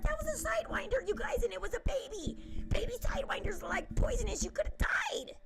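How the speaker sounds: high, fast, panicked mom voice; imitating a frantic mom; mom freaking out. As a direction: squeal it out like a terrified mother. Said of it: "My mom was freaking out".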